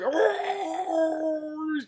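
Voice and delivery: high, drawn-out wail; imitated shark cry. Shark bursts out with princess in mouth, imitated wail.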